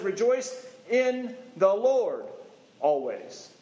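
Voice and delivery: "Lord" stressed, slow; slow, stressed "Rejoice in the Lord always."; slow emphatic quote. Shown as a demonstration. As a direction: lean on "Lord". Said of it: "the key phrase in here".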